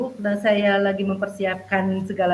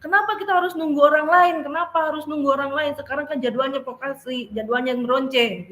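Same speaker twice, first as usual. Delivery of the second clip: tirukan anak mengomel, nada jauh lebih tinggi; 6 semitones higher than usual. Retold or imitated. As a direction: menggerutu protes. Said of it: "masih dia ngomel-ngomel". (anak mengomel kenapa harus menunggu teman)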